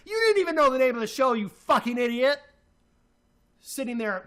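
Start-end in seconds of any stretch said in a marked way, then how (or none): 0.1-2.4 fast, higher, louder furious yell; real fury breaking through; angry outburst. Real, not acted. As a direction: blow up at him, rapid-fire.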